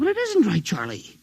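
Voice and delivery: high, urgent insistence; pressing the alarm home; agitated. Acted. Clerk insists to the radio man, agitated and high.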